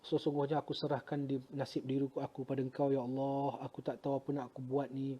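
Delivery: soft, pleading prayer; retold supplication. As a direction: surrender to God in a hushed voice. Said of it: "saya tadas tangan pada Allah". (doa berserah dengan suara perlahan)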